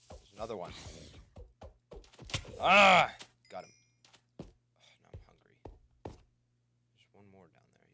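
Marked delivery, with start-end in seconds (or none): 2.6-3.1 short, high, loud attack yell; loud "Ah!" while striking. Real, not acted. Attacks a monster.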